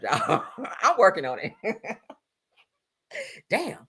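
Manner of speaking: ordinary delivery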